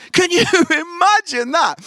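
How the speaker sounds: high, loud, laughing through it. Real, not acted. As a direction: crack up while asking. Laughing at little Tony.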